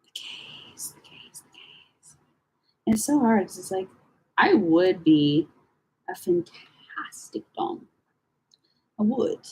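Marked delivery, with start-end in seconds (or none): 0.0-2.2 whispered, slow chant-like repetition. Real, not acted. Musing to herself while scrolling, whispered. 6.1-7.9 whispered, slowed confession. Real, not acted. Confiding to viewers about herself, whispered.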